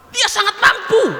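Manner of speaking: put-on the sheikh answers the drunkard, slow and high with a chuckle; sheikh's reply. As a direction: answer with laughing certainty. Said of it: "kata Syekh Abdul Qadir Jailani".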